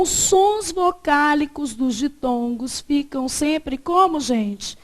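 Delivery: usual speaking voice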